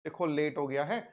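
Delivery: quick, soft polite brush-off; जल्दी में विनम्र मना करना; polite refusal. Retold or imitated. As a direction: rattle off a tired, polite no. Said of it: "I refused politely by saying".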